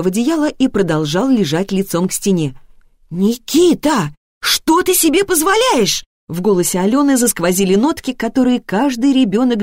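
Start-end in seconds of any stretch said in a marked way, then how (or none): 3.1-6.0 ringing, high-pitched steel; slow, drawn-out warning from an angry mother; steely parental reprimand. A narrator's reading. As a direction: scold your child with rising steel. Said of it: "Звенящие стальные интонации".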